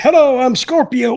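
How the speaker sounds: loud, high shouted hello; shouted greeting. Retold or imitated. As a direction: bark out the greeting. Acting out Scorpio's greeting.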